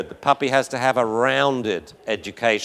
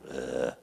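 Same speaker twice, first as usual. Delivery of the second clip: very low, quiet grunt; a short soft growl in the throat; imitated low growl; 13 semitones lower, 11 dB quieter than usual. Whip bluffing at a puppy, low soft growl. Imitates Whip's gentle threat.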